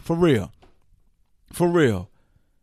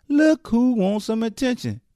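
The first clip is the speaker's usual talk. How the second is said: higher sing-song mock; mocking taunt; 8 semitones higher than usual. Shown as a demonstration. Host taunting the attention seeker, higher mocking sing-song. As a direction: tease her like a schoolyard taunt.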